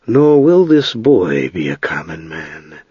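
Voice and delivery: a touch of grave authority, taking its time; mage's grave answer. A narrator's reading. Mage answering the bronze smith, slow and grave.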